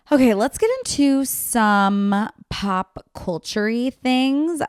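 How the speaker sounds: normal talking voice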